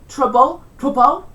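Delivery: loud Cockney TROUBLE ending in a vowel; L vocalisation. Shown as a demonstration. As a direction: say "trouble" as "trubboo", no final L. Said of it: "L at the end of words often becomes a vowel".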